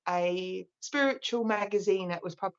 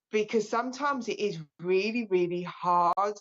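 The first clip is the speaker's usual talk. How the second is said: normal talking voice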